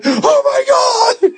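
high, drawn-out mock wail; hammed-up tearful cry, very high; mock bawling. Retelling his healing, high mock bawling. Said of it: "I'm bawling".

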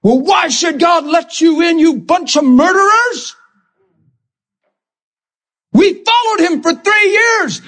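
mock condemning apostle, shouting; scornful scolding. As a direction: berate the crowd as a bitter disciple. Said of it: "he didn't say".

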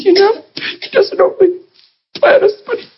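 a touch of crying, voice pushed up high; imitating tearful squeak. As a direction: echo her choked-up tearful words. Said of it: "get squeaky voiced and so sweet and tears pouring".

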